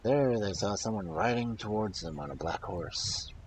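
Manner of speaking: ordinary delivery, nothing marked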